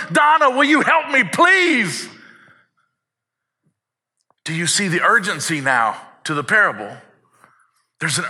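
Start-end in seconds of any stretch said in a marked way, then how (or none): 0.1-2.1 much higher, louder pleading cry of a name; desperate plea. Retold or imitated. God begging Donna for help, much higher and louder. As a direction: call her by name, begging. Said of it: "he's like".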